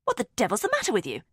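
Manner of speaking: brisk annoyed question; a hint of irritated sister. A narrator's reading. Julia irritated at Amelia.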